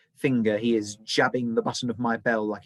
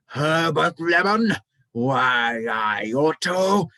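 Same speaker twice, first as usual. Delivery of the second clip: high, loud, slow sputtering rage; enraged Mister Mollusk; 6 semitones higher, 7 dB louder, slower than usual. A narrator's reading. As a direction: splutter with fury at the boy. Manager crimson with rage.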